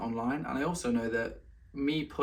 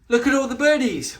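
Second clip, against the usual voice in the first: high, loud coo over birdies; excited pet talk; 11 semitones higher, 9 dB louder than usual. Real, not acted. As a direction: pitch it right up, as if to a pet. Talking about pet watching birds.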